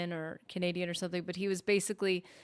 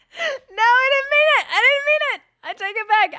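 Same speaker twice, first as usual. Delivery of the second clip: high, loud, pleading retraction; mock panic; 12 dB louder than usual. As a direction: backpedal in a loud high squeal.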